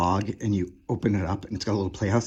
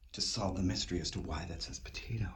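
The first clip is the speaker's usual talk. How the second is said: rushed, hushed whisper; quick whisper; 4 semitones lower, 11 dB quieter, faster than usual. Real, not acted. Vlogger whispering a joke aside about a potato label. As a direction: whisper the joke fast under your breath. Joking aside while browsing toys.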